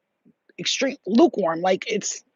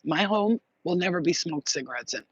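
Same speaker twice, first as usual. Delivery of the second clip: ordinary talk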